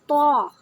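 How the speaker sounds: loud, mouth-filling emphatic "تو"; heavy Taw. Shown as a demonstration. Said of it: "بھاری کر کے لفظ پڑھتے ہیں". ("تو" بھاری، منہ بھر کے)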